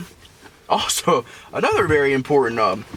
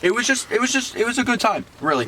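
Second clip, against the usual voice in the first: ordinary delivery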